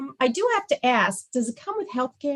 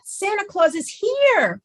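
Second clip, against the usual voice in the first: 1 excited announcement; 8 semitones higher than usual. Acted. Announcing Santa's arrival excitedly.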